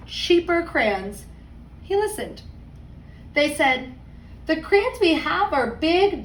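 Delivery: put-on lively read-aloud voice for kids; story narration. A narrator's reading. Teacher reading a picture book to her class, storytime voice.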